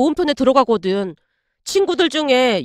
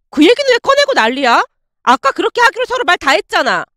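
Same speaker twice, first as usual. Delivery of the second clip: higher, louder outburst in a female voice; losing her temper; 5 semitones higher, 3 dB louder than usual. Acted. Ex-girlfriend blowing up at her fiancé, higher angry outburst. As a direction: snap back as if she has lost it. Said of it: "이성을 잃고 말았어요".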